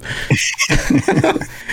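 airy high-pitched giggle; high breathy laugh. Real, not acted. Laughs at own joke.

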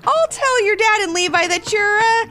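high, mildly panicked mom; flustered exclamation. A narrator's reading. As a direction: think aloud, hesitating over an excuse. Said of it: "exclaimed her mom".

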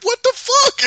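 very high, loud squawk; mock outraged shock. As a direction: blurt it in hammed-up disbelief. Acting out the man's shock.

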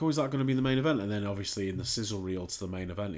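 normal talking voice

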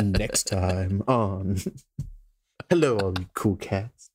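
slow, low seductive voice; sexy voice. Pretend. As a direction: ham up a sultry radio voice. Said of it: "you wanna give us a taste?".

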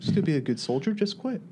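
imitated instructor, pitch climbing in invitation; instructor's lecture. Instructor tempting exhausted trainees to quit, mock coaxing. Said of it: "one of the instructors coming and lecturing us".

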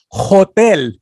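put-on young me saying Hotel with the H; pronouncing the H. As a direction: hit the H in Hotel. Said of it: "It's like, I would say".

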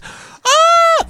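high delighted shriek; excited shriek. Retold or imitated. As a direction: squeal with sudden joy. Wife freaks out at the news.

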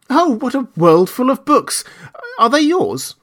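put-on high-pitched child's wonder; excited cry. A narrator's reading. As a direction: cry out like an amazed little boy. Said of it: "when Lionel cried out".

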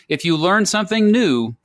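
ordinary delivery

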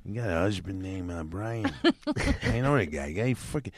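New York wise-guy uncle voice; Uncle Dominic. Acted. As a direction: play an old neighborhood guy collecting a debt. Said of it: "Like Uncle Dominic all day long".

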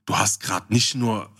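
normal talking voice

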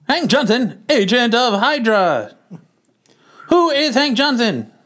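high, dramatic newsreel announcer; old-timey narrator. A narrator's reading. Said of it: "me as the old-timey narrator".

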